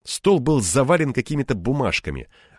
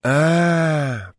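long, higher "А" of realisation; drawn-out; 6 semitones higher than usual. Acted. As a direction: stretch out the "А" as it dawns. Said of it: "протянул я". (протяжно, с догадкой)

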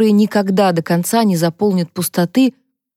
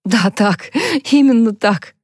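higher-pitched, forced laugh through the words; strained laugh; 4 semitones higher, slower than usual. A narrator's reading. Harper agreeing with Johnny through tears, strained laugh. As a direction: laugh weakly, as if it costs effort. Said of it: "Харпер вымученно засмеялась".

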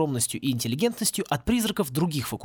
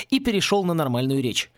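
plain reading voice

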